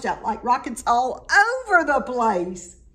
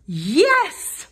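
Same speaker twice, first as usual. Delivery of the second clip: excited yes; 5 semitones higher, 5 dB louder than usual. A narrator's reading. Gertrude finds the pill vine.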